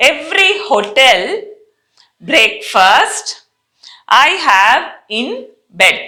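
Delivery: slow, word-by-word sentence for repeating; slow model reading. Shown as a demonstration. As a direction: spell out each word slowly for the class. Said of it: "first నేను చదువుతాను వినండి".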